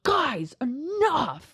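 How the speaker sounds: mild mock anger; mock annoyed. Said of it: "He doesn't get mad".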